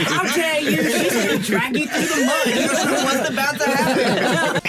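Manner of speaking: loud, high mock outrage through laughter; mock fan attack. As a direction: imitate an outraged mob of fans. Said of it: "like, attacked me".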